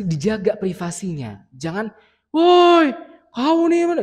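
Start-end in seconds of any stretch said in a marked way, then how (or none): 2.3-4.0 sudden loud mock shout, much higher; mock yell. Shown as a demonstration. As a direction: bark it like a rude public scolding. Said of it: "Nggak boleh gitu di depan orang lain diteriakin". (teriakan kasar yang dicontohkan)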